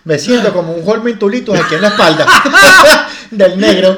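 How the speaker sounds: loud, laughing talk; cracking up. Real, not acted. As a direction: laugh through the words. Laughing nervous banter.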